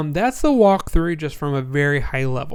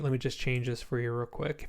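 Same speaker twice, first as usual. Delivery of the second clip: ordinary talk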